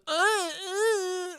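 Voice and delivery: high, drawn-out fake moaning; mock moan. Nephew mocking his uncle's idea of blowjob talk, mock moan. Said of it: "we're not moaning like".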